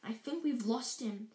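ordinary delivery, nothing marked

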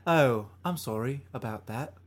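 polite put-on British accent; mock British. Host answers in the accent game, polite mock British. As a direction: apologize like a courteous Brit.

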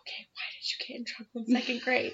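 breathy whispered question; whispered question to a stepdaughter in a movie theater. Retold or imitated. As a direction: whisper so nobody nearby hears.

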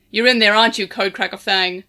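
loud, deep call through a door; deep bellow. Acted. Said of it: "A deep voice bellowed".

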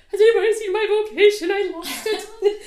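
high, loud, laughing panicked voice; frantic searcher voice. Retold or imitated. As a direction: play someone frantically hunting for a lost thing. Mocking someone hunting for vocation.

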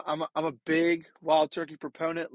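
usual speaking voice

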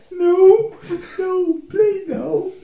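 high, drawn-out mock-terrified plea; hammed-up helpless begging; scared pleading. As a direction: whimper it like a frightened child. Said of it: "Scared the fucking shit out of me".

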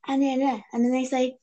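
ordinary delivery

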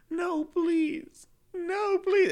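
put-on high whiny little-girl begging; childish pleading. Young student begs teacher not to sign the folder, high whiny plea. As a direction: plead like a scared third grader. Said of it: "I was like".

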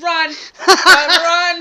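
loud laughing warning, high; laughing yell. Real, not acted. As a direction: shout it through laughter. Friend's scary eyes, laughing warning.

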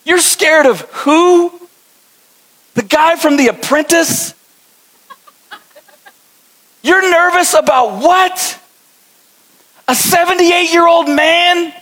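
disbelieving heavenly witnesses asking what we fear; incredulous onlookers. Retold or imitated. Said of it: "They're going".

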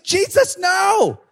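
very high mock alarm; hammed-up high plea to stop; panicked protest. Disciple stopping Jesus talking to a demon, high panicked protest. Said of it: "I'd be like".

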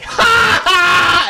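very high, loud cackling ha ha; mocking laugh. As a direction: laugh loudly right in their face.

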